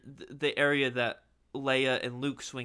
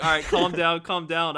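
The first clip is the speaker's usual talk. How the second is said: louder mock teacher breaking it up; calm them down like a teacher, half-hearted; imitating teacher; 4 semitones higher, 9 dB louder than usual. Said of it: "the teacher was like".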